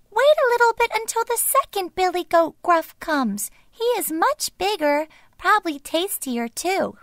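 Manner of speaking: high little goat pleading; wee, quivering voice. A narrator's reading. Tiny goat talking the troll round, wee high voice. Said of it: "wee, quivering voice".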